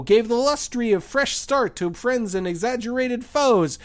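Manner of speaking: lilting holiday poem reading; poem recital. Acted. Reads parody poem aloud.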